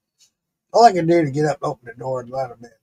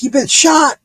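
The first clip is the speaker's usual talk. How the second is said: high, louder startled question; alarmed dispatcher; 9 semitones higher, 5 dB louder than usual. Retold or imitated. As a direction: imitate an alarmed operator. Dispatcher mishears, voiced in retelling.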